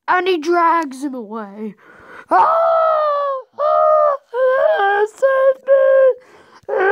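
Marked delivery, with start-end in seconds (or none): 2.3-6.9 high, frantic screams for help; terrified screams. Acted. Victim being dragged away, screaming in terror.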